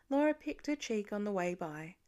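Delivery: ordinary delivery, nothing marked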